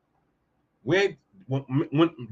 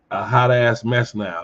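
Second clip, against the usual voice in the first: ordinary talk, nothing marked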